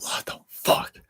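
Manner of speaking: mouthed in a breathy whisper; whispered disbelief. Retold or imitated. Reacting to a partner's mood swings, whispered disbelief. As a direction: hiss it in disbelief. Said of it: "and it's like".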